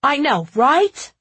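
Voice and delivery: loud, slow rise on RIGHT; high, drawn-out question; catchphrase topping the other. A narrator's reading. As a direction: outdo the last echo playfully. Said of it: "Spencer sat on top of him".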